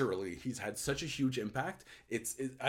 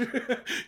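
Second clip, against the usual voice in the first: breathy laugh; 6 dB louder than usual. Real, not acted. Laughs at immersion joke.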